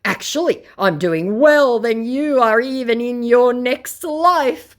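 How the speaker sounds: bragging scammer. Retold or imitated. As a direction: boast like a puffed-up conman. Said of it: "brags my scam with nothing".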